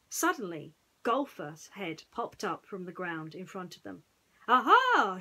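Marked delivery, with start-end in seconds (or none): 4.5-5.2 loud triumphant aha; triumphant shout. A narrator's reading. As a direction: crow in triumph. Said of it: "shouted Pooh triumphantly".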